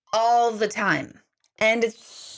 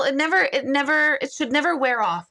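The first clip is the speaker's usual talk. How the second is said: normal talking voice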